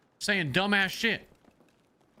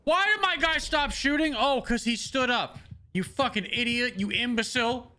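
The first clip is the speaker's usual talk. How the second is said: frustrated rant; 2 semitones higher than usual. Real, not acted. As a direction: rage at your own game character. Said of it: "You got me so fucking frustrated".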